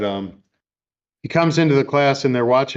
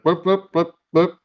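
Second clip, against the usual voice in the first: imitated police siren bleeps, pitched up; siren beeps; 6 semitones higher than usual. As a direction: mimic the short siren whoops. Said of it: "his dad flips the siren beep and it's like".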